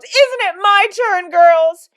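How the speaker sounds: high, loud, slow wail; bawling yell. A narrator's reading. Mother appeals to the twins over the car, bawling. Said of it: "she yelled".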